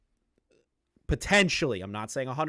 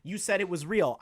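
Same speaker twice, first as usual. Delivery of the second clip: lightly mocked, higher-pitched complainers; a hint of a put-on protesting voice; mock objectors; 5 semitones higher than usual. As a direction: imitate viewers piping up to object. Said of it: "a lot of people are gonna be like".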